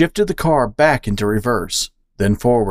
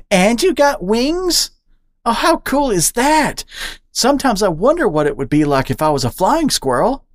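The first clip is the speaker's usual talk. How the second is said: amazed squirrel; 11 semitones higher than usual. Acted. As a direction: marvel at the bat's wings. Charlie marvelling at wings.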